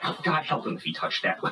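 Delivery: ordinary talk, nothing marked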